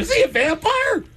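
very high, squeaky alarmed mother; shocked mom. Retold or imitated. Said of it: "And you're like".

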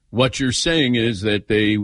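usual speaking voice

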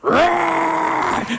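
high, shouted mock roar; answering roar. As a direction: roar back playfully at the child. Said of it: "I said".

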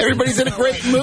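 high, fast announcer voice; radio announcer voice. Shown as a demonstration. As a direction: hype it like a game show announcer. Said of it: "Sounds like Dracula".

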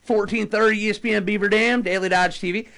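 put-on slow, drawn-out Southern accent. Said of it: "Southern Wally".

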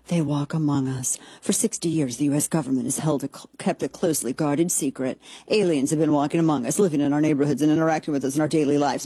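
low, ominous movie-trailer read; dramatic read. Acted. Vlogger reading the alien website aloud, lightly ominous. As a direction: read it like a conspiracy promo.

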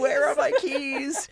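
high, slow, laughing through it; laughing mock wail. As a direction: quote the impression while cracking up. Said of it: "I quote that now".

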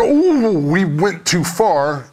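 high "ooh", then slow regret; hammed-up realisation; mock dismay. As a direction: act out the staff backing off, slowly. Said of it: "and then".

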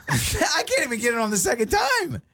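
laughing through the words; giggling at own mistake. Real, not acted.

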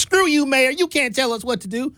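high, mocking sneer at the mayor; high snotty voice telling off the mayor; defiant citizen voice. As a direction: jeer at him like a snotty resident.

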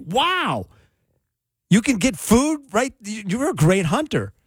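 high, drawn-out mock awe; amazed dog. Dog to owner about food at the door, wowed and impressed. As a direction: marvel slowly, as a dog impressed by its master. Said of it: "My dog's like".

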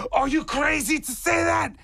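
high, incredulous tourist; excited stranger. Retold or imitated. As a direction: squeak out the stranger's disbelief. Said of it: "And he goes".

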